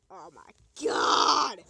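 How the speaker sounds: slow, drawn-out loud cry of shock; a drawn-out, startled outburst; shocked yell. Real, not acted. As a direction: blurt it out in sudden alarm. Sudden shock in game.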